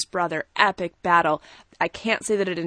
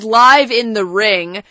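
excited; 2 semitones higher, 10 dB louder, much slower than usual. Real, not acted. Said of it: "I exclaimed how excited I was".

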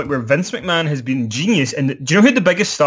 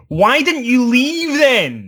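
incredulous; 10 semitones higher, much slower than usual. Retold or imitated. Retorts to Ziggler's complaint.

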